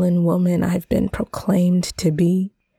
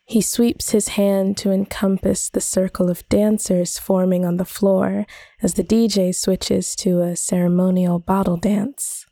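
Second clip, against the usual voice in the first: plain reading voice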